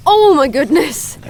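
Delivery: loud, drawn-out astonished outburst; too-loud exclamation. Acted. Streamer startled by a badger on camera, exclaiming too loudly. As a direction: forget to keep your voice down. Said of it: "I need to keep my voice down".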